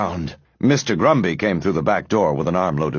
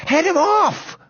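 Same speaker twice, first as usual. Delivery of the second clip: high, winded urgent cry; panting shout; slower than usual. A narrator's reading. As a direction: gasp out an order mid-chase. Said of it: "panted Henry".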